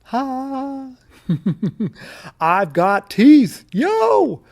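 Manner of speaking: high, loud, showing off new teeth; goofy monster voice. Acted. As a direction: ham it up like a cartoon monster, slow on the teeth line. Showing off freaky fake teeth.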